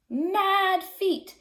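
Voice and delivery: ordinary delivery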